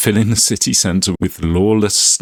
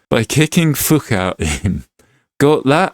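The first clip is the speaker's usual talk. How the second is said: emphatic put-on Glaswegian; Scottish accent; 5 semitones higher than usual. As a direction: hammer it home in mock Scottish. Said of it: "Sorry about the Scottish accent".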